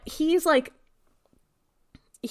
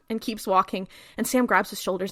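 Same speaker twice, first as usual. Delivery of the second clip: usual speaking voice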